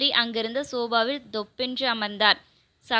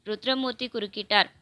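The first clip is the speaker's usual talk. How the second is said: ordinary delivery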